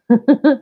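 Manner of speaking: quick bright laugh; short laugh. Real, not acted. Laughing at her own joke to viewers, short laugh. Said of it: "laughs after joke".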